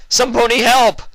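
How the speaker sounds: high, loud panicked cry; desperate scream for help. Acted. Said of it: "individual shouts and screams".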